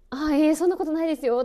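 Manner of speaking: acted-out anxious politeness; considerate denial. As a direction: rush to reassure, fussing over the other person. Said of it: "こう気を使うような".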